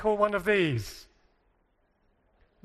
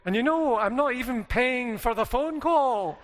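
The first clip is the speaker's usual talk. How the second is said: high, drawn-out Glaswegian impersonation; Glasgow accent; 5 semitones higher, slower than usual. Retold or imitated. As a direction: stretch it out, imitating a Glasgow accent. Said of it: "should do my Glasgow accent".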